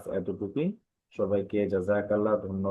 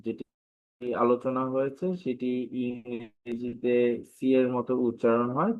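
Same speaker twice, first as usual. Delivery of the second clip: normal talking voice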